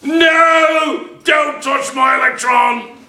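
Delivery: high, drawn-out cartoon wail; hammed-up high-pitched shout; protesting chlorine. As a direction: cry out as a panicky element guarding its electron. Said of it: "That chlorine is saying".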